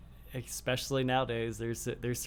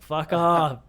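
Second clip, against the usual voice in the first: loud, higher mock-angry snap; retold irritated outburst; 5 semitones higher, 13 dB louder than usual. Reacting to Kate's annoying accent, mock-angry outburst. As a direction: burst out loudly, fed up. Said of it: "it fucking drives me fucking nuts".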